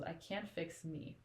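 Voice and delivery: quiet and slow, like it hurts to say; slowly, barely above a breath; soft, slow, broken line. Retold or imitated. Reader voicing Nesta's last despairing words, soft and slow. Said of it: "all she says was".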